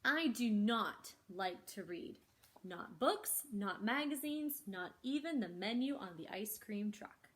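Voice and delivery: lively, expressive picture-book reading; reading to children. Acted. Librarian reads the story to children, animated storytelling. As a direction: bring each line to life for the kids.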